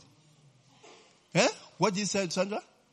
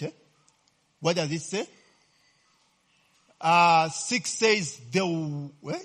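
normal talking voice

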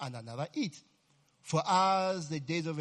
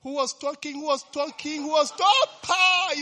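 storming prep-time enforcer; 10 semitones higher, 8 dB louder than usual. Retold or imitated. Brother Bugs bursting in on chattering students, building shout. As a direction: demand it louder and higher each time. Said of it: "the guy would just enter and say".

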